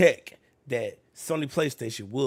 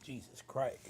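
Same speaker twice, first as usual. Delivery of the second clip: ordinary delivery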